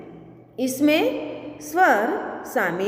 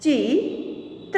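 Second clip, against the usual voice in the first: slow, each sound drawn out, rising at the end; slow drawn-out reading. Shown as a demonstration. Teacher slowly sounding out a word for students, drawn out. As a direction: stretch the word slowly for the class. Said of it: "आगे देखें".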